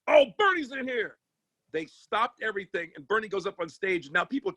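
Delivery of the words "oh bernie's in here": excited DJ. Retold or imitated. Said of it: "The D J goes".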